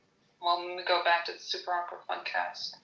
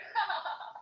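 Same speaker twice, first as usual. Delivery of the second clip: high burst of imitated laughter; mock explosive laugh; 13 semitones higher, 4 dB quieter than usual. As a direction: act out her exploding laugh. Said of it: "She explodes with laughter".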